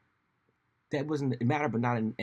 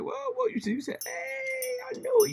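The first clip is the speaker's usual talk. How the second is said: much higher, squeaky mocking voice; mock high squeal; 5 dB louder, slower than usual. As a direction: squeal it like a panicking pundit. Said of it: "It was like".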